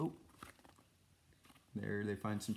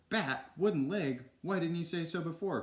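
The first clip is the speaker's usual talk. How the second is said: higher-pitched urgent exclamation; excited cry; 8 semitones higher, 4 dB louder than usual. A narrator's reading. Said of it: "cried Basil".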